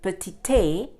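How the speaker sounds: petite with an added "ay", "puh-tee-TAY"; wrongly voiced final E. Shown as a demonstration. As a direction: sound the last E of petite as "ay". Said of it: "It is not petite".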